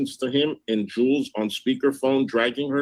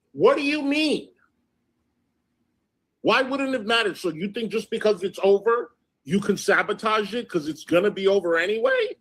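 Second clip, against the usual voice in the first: high-pitched, indignant questioning; exasperated, voice shooting up; incredulous challenge; 6 semitones higher than usual. Real, not acted. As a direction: demand an explanation in disbelief. Challenging the excuse she just read.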